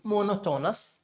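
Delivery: monotonous with full O's, mo-no-to-nus; monotonous as "mo-no-TOE-nus"; wrong MONOTONOUS. Shown as a demonstration. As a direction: sound every O in monotonous as "oh". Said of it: "So don't say".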